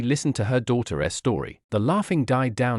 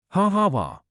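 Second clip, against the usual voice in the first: put-on high teasing kid laugh; a cheeky little laugh; mocking laugh; 9 semitones higher than usual. A narrator's reading. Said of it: "Goten adds and starts to run off".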